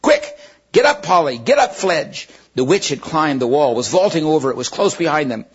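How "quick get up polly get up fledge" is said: loud urgent shout. Acted. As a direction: yell for them to mount up. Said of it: "he was shouting".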